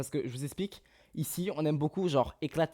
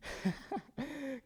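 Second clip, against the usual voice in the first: breathy laugh; 12 semitones higher, 11 dB quieter than usual. Real, not acted. Laughs after being out of breath.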